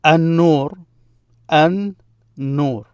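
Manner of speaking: An-Nur with a long doubled N, no L; assimilated N. Shown as a demonstration. Teacher showing where the stress mark came from, An-Nur with doubled N. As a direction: hold the N in An-Nur. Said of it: "this transformation of this N".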